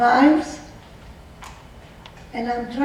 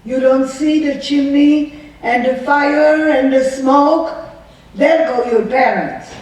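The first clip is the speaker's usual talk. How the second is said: loud, higher mock yell at a child; retold yell; 4 semitones higher, 6 dB louder than usual. Guard yelling at the girl about the chimney, a loud retold yell. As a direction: bark it back as the guard did. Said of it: "she yells at me".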